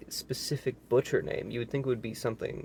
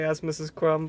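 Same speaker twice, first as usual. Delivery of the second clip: ordinary delivery, nothing marked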